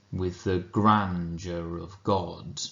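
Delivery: slow, light With the, weight on grandeur and God; slow anapest reading, stress landing on grandeur. Shown as a demonstration. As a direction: lean into grandeur and God, skim the small words. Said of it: "two unstressed followed by a stress".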